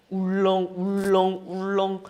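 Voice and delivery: slow-rolling queasy chant; queasy churning. Shown as a demonstration. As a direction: stretch each word like a heaving stomach. Acting out queasy stomach churning.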